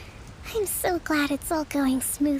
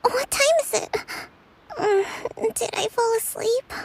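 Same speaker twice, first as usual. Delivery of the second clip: jolted awake; 5 semitones higher than usual. Acted. Kokomi waking with a start.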